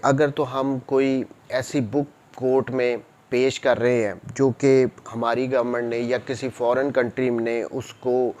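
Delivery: ordinary talk, nothing marked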